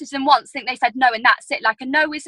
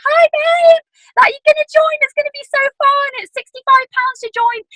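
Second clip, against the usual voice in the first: very high, louder imitation of a Manchester friend; attempted Manchester accent; 12 semitones higher, 4 dB louder than usual. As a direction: put on a bubbly, high-pitched Manchester voice. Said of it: "I can't do her Manchester accent, but she was like".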